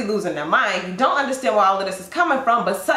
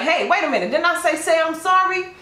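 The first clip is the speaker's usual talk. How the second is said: higher, offended demand; indignant call; 6 semitones higher than usual. Acted. Stranger calling after someone walking away, higher and indignant. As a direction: call them back with entitled outrage.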